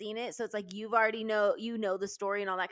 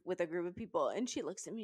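ordinary delivery

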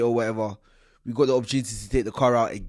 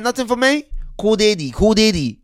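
put-on high, loud Nigerian accent; demanding cop voice; Nigerian police officer; 9 semitones higher, 9 dB louder than usual. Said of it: "He said".

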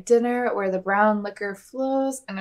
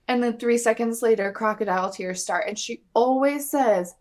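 normal talking voice

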